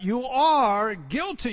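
slow, pointed, each word weighed; slow accusation. Real, not acted. Preacher confronting listeners with their guilt, slow and pointed. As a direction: lay it on them slowly.

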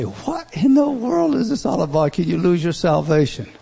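high-pitched, incredulous outburst; baffled listener. Acted. Voicing a baffled listener's objection.

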